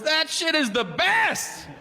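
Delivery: high, slow, dreamy crackhead; slow stoner voice. Retold or imitated. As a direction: savor every word.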